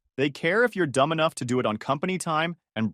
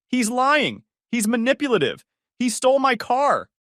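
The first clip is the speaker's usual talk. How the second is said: much higher, louder accusing shout; 11 semitones higher, 5 dB louder than usual. A narrator's reading. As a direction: yell at him past the guards.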